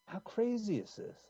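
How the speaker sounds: normal talking voice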